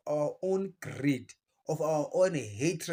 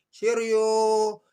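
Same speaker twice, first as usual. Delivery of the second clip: sing-song high mocking cheerio, louder; 7 semitones higher, 9 dB louder than usual. Telling Britain goodbye, high taunting cheerio.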